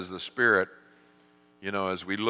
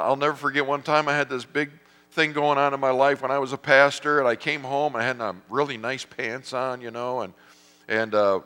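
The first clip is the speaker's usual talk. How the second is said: ordinary talk, nothing marked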